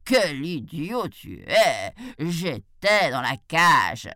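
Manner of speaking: slow, loud scolding; exasperated tiger. A narrator's reading. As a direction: hammer it out slowly at an idiot. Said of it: "l'interrompit le tigre". (tigre exaspéré, lent et appuyé)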